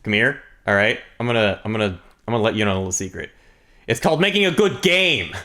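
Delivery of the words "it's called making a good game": emphatic punchline. Real, not acted. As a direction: proclaim it high and emphatic. Telling a "secret" punchline.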